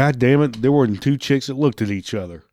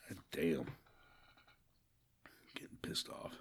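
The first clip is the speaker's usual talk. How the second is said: barely breathed, unvoiced aside; whisper; 7 semitones lower, 25 dB quieter than usual. Real, not acted. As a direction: whisper it under your breath. Muttered aside after pause.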